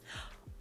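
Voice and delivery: sharp, quiet intake of shock; soft gasp. A narrator's reading. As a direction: gasp softly in disbelief. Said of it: "you gasped softly".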